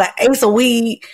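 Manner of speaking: ordinary talk, nothing marked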